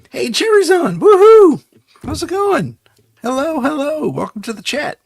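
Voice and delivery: high, loud cheerful welcome; excited greeting. Real, not acted. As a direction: cheer as a friend arrives. Greets viewer Jerry.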